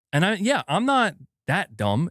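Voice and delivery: ordinary talk, nothing marked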